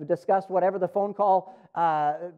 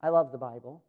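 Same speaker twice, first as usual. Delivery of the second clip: low, softer, throwaway line; offhand cliché; 6 semitones lower, 5 dB quieter than usual. Shown as a demonstration. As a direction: say it like a stock phrase, lower and softer. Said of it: "It's not just".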